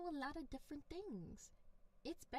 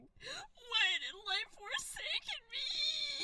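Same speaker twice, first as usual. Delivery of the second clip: slow, louder, higher mock sob; mock crying; 9 semitones higher, 5 dB louder, much slower than usual. Streamer imagines crying into a pillow, mock sobbing. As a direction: wail it like a hammed-up tragedy. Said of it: "I'm gonna be like".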